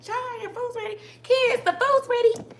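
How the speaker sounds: put-on very high call to the kids. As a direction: mimic Miss Netta calling Child, your food's ready up high. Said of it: "say it like that, I always think of Miss Netta".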